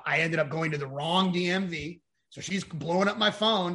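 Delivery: ordinary talk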